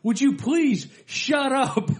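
high, slow, laughing exasperated plea; annoyed theatergoer. Retold or imitated. Front row guy hushing the heckler, slow high plea. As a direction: plead slowly, half laughing. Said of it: "the guy at the bottom".

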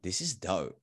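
hushed, low private thought; inward awe. Retold or imitated. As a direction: say it under the surface, softly to yourself. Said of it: "But inside, I was like".

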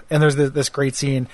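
ordinary delivery

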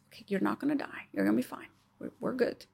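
put-on calm yourself down softly like comforting a child; soft reassurance. Telling your own body you will not die, soft and reassuring. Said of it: "and we trust".

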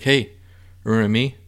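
a mock shout of hey; loud mock hail. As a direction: grab his attention like a pushy stranger. Time confronts him, calling out.

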